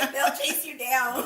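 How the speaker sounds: ordinary talk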